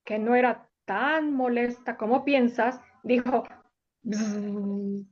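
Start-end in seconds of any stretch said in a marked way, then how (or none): 4.0-5.1 low, soft buzzing like a little bee. Acted. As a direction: buzz it out gently like a bee. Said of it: "con su mejor voz".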